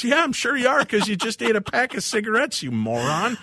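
high sarcastic wife voice. Retold or imitated. Wife sarcastic, acted quote.

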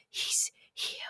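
hushed, fearful phone whisper; barely audible whisper. Retold or imitated. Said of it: "I'm whispering to him".

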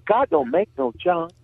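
high Southern church lady voice; Southern accent. Retold or imitated. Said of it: "She says".

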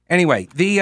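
ordinary talk, nothing marked

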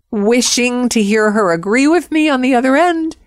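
normal talking voice

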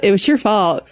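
laughing as she teases; chuckling through the words. Real, not acted. Teasing banter with the host.

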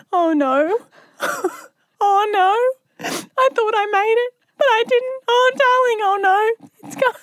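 high, frantic mock distress; playing up a pretend accident; fake panic. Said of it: "I'm like".

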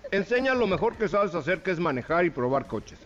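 talking through a mouthful; words around a bite of food; mouth full. Real, not acted. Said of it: "Perdón que hable con la boca llena". (con la boca llena)

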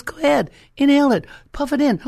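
high, loud, quick mock orders; pushy grandpa. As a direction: urge the child on as grandpa, fast and forceful. Grandpa forcing child to inhale.

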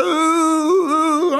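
high, hyped oohs pulling out in the Bentley; a showy, thrilled coo at the car; excited ooh. Retold or imitated. Showing off the new Bentley.

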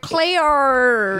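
high, loud, broad Australian "Cleo."; exaggerated Australian "Cleo" as a joke; broad Aussie Cleo. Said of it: "purposely say Cleo after it".

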